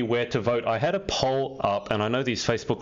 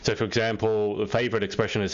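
ordinary talk

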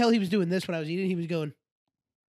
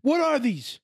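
mock pep talk, slow and pitched up; pregame hype speech; 7 semitones higher, slower than usual. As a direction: imitate an athlete firing up the team. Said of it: "giving a pregame hype speech".

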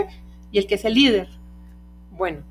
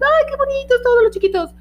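cooing visitor; 6 dB louder than usual. Retold or imitated. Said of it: "la gente se acerca a todos los perros".

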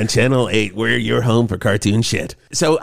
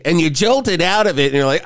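normal talking voice

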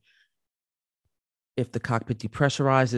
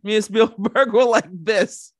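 mock-proud boast; 9 semitones higher, 7 dB louder than usual. Real, not acted. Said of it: "playful boast".

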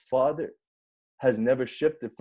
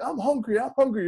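high-pitched mock baby cry; crying baby's voice; 10 semitones higher than usual. Dad voicing his hungry crying baby, high imitated cry. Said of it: "He just crying".

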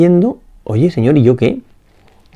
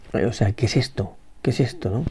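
hushed bewilderment; 2 semitones lower, 11 dB quieter than usual. Retold or imitated. Blind man's inner bewilderment voiced.

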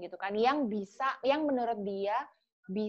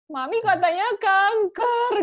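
much higher, louder, slow breaking voice; retold crying; 11 semitones higher, 10 dB louder, slower than usual. Telling her father's boss the news, high, slow and tearful. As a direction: let the words break as if crying. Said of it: "Gue nangis".

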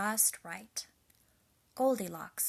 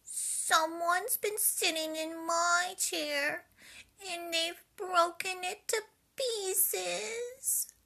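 much higher, slow little bear; crying Baby Bear; 10 semitones higher, 4 dB louder, slower than usual. A narrator's reading. Baby Bear finding his broken chair, high slow crying. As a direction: whimper it like a heartbroken cub. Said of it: "cried Baby Bear".

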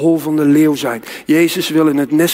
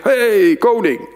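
bright hail to the king, pitched higher; raised, higher-pitched call; 4 semitones higher, much slower than usual. Pretend. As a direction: call out a greeting to royalty. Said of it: "Jij zegt".

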